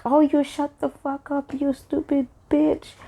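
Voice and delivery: high, soft mocking imitation voice; mimicking the girl. Girl snapping back at the peacemaker, high mocking imitation. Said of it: "And she's like".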